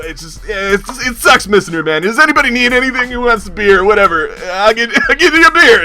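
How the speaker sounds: high, stumbling, emotional tribute; tearing up. Acted. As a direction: cover rising tears with hasty cheer. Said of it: "he's starting to tear up".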